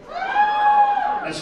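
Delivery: high, slow stretched squeal; high mock squeal. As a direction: draw it out in a falsetto wail.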